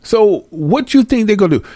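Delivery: high put-on voice of a rattled official; very high caricature of a politician; mock official. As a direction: play the bigwig realizing it. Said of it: "They sitting there talking about".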